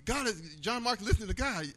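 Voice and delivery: high, excited re-enacted self-talk; real excited. Man realizing his friend listens to God, excited retelling. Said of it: "I getting real excited".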